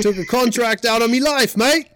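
loud, high hammed-up Aussie; Australian accent. Host replaying his slip, exaggerated loud Australian accent. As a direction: ham it up in Australian. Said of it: "you kinda said life".

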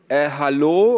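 loud, high indignant hello; calling out. A narrator's reading. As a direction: call out from the doorway. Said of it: "rief Ada von der Tür".

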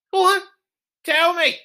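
hammed-up high outburst; shrill demand. Confronting rival drinkers.

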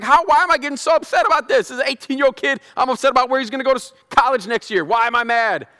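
a mock-indignant fan asking why he cares; exasperated self-questioning. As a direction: vent at yourself in disbelief. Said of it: "I was like".

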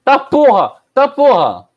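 loud, high startled yell; startled shout. Real, not acted. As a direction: yell out in sudden fright. Shock at a sudden ghostly feeling.

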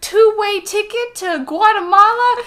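a whiny ape clinging to his treasures; mock Donkey Kong. Friend retells the cartoon, as a whiny Donkey Kong. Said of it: "And he's like".

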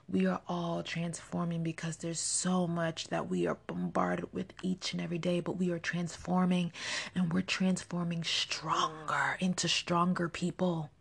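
ordinary talk, nothing marked

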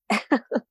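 short, amused real laugh; breathy little chuckle mid-thought. Real, not acted. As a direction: let out a brief laugh at the thought. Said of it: "I'm just laughing because".

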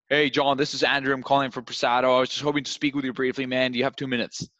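rushed, overeager pitch; too fast, too much energy. Shown as a demonstration. Rep overwhelming a calm prospect with speed and energy. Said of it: "You're going too fast, too much energy".

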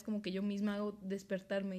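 ordinary talk, nothing marked

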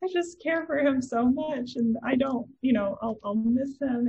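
high, choked-up grandmother voice; a grandma fighting tears; tearful grandmother. Retold or imitated. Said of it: "she's like".

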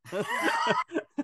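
squeaky burst of laughter; high laugh. Real, not acted. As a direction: laugh at the punchline. Laughing at own story.

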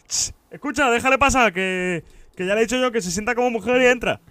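high, loud, smug mock guard voice; mock security guard. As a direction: ham up a know-it-all guard laying down the law. Guard voiced talking to attendant. (imitando con burla a un segurata sabelotodo, agudo y fuerte)

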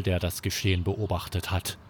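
plain reading voice

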